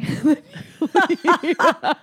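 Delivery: bursting out laughing; big real laugh; loud laugh. Real, not acted. Laughing at her own story.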